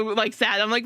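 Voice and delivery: normal talking voice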